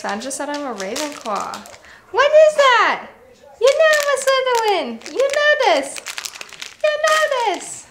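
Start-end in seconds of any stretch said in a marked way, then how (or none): 2.1-7.9 high pet-talk voice. Real, not acted. To her dogs over a toy, high squeaky pet talk. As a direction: coo at the puppy in a squeaky voice.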